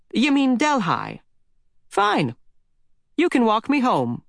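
high, brisk granny ordering about; bossy old lady. Acted. Old lady ordering boy to pick strawberries, high and brisk. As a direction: chivvy the boy along like a busy granny.

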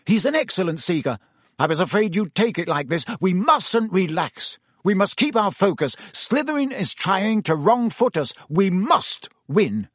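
a coach drilling focus into the team; intense captain. A narrator's reading. Wood voiced, intense.

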